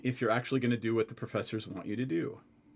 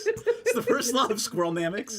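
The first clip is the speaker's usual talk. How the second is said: higher, louder laughing punchline; 11 semitones higher, 5 dB louder than usual. Real, not acted. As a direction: deliver it laughing.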